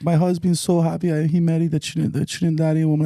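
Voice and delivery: put-on high-pitched Caribbean accent; mock Caribbean accent. Retelling a customer's words to friends, mock Caribbean accent. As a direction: imitate a Trinidadian woman in Caribbean accent. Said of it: "like, an accent".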